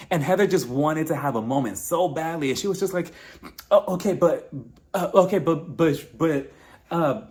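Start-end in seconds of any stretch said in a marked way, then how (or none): 3.7-7.2 mock sputtering for words; halting, tongue-tied mockery; flustered stammering. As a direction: imitate someone trying and failing to interrupt. Mocks Heather lost for words.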